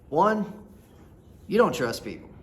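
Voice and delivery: usual speaking voice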